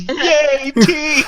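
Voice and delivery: proud cheer. Retold or imitated. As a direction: cheer them on like a proud fan. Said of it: "really proud of you".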